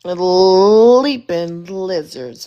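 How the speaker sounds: loud, slow catchphrase of amazement; drawn-out exclamation. Real, not acted. Host reacts once again to panelist's retort, drawn-out amazement. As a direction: draw out the first word loudly.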